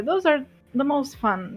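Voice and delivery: usual speaking voice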